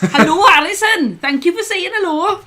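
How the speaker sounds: exaggerated Welsh hello, slow and loud; Welsh accent. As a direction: greet him in a broad Welsh accent. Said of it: "That's a very extreme Welsh, um, accent".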